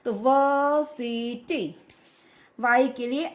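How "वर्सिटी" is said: "वर्सिटी" slowly as vuh-si-tee, no r; silent R. Shown as a demonstration. Teacher explaining R before S, "वर्सिटी" slow with silent r. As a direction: drop the r in "वर्सिटी", syllable by syllable. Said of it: "इस आर का उच्चारण नहीं होगा".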